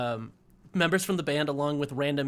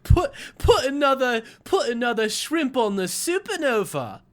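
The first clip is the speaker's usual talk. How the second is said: higher-pitched, hammed-up Aussie accent; Australian accent; 7 semitones higher, 3 dB louder than usual. Shown as a demonstration. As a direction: play up a bad Australian accent. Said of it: "That's Australian".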